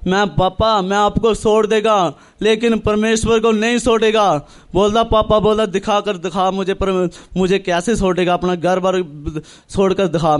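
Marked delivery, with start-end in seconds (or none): none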